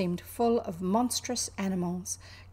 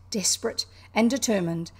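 ordinary delivery, nothing marked

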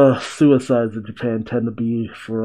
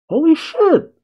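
Holy shit! much higher and louder, a real yelp; shocked outburst; 5 dB louder than usual. Real, not acted. Viewer realizing the grandpa really died, shocked yelp. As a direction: blurt it out in sudden shock.

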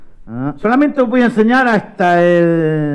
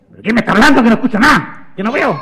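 higher, agitated questioning; flustered, higher-pitched protest; exasperated confusion; 5 semitones higher, faster than usual. Acted. María cannot find the voice.

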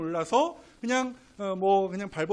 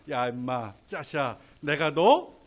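lower, affectionate buddy scolding; a fond, mocking "you silly" to a friend; teasing Jesus voice; 4 semitones lower than usual. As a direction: talk like an old friend ribbing a pal. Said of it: "웃으시면서 하셨던 말씀".

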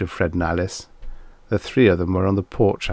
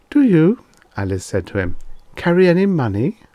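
lightly raised, curious woman's voice; a touch of a high wife's voice; 9 semitones higher, 5 dB louder than usual. A narrator's reading. Narrator voicing the wife asking a stranger about money. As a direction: ask it a little higher and brighter.

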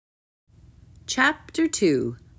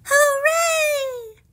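very high, gleeful cheer; high princess squeal of delight; joyful shout; 3 dB louder than usual. A narrator's reading. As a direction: cheer at the top of your range. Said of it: "shouted Poppy".

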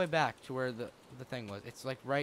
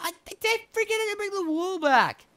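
very high, loud whine; high-pitched hammed-up complaining; mock lament; 5 dB louder than usual. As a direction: wail it in exaggerated dismay. Laments own blunder in game.

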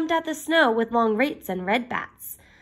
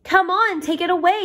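yelled; 8 semitones higher than usual. A narrator's reading. Little cat A urging the cats to clean, higher yell. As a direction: shout it up high, urging them on. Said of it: "yelled little cat A".

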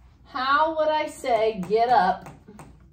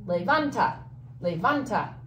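Levanta with the stress on VAN; stress on second-to-last syllable. Shown as a demonstration. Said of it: "the accent needs to go on the A".

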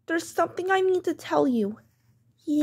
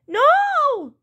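high, drawn-out protest cry; wailing child; 8 semitones higher, 4 dB louder than usual. Acted. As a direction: wail in refusal at the punishment.

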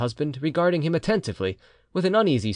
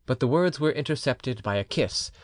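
ordinary delivery, nothing marked

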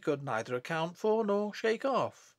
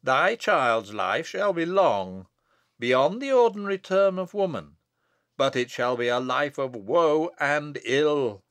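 prophetic; 5 dB louder than usual. Acted. Abbot foretells the infant's woeful life, prophetic. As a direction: declaim like a prophet pronouncing doom. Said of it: "as if filled with a prophetic spirit".

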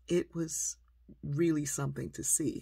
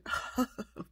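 imitated disbelieving chuckle, higher pitch; mock laugh at boastful parents; doctor's dismissive laugh; 8 semitones higher than usual.

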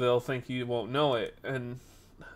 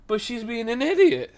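high-pitched exasperated protest, retold; mock exasperated outburst; blurted out loud; 9 semitones higher, 4 dB louder than usual. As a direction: blurt the complaint in a raised pitch. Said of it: "I said that out loud".

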